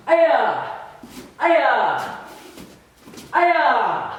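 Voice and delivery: three sharp martial arts yells; kiai shouts. Shown as a demonstration. Instructor yelling with each strike of the combo. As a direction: punch out each aya on a strike.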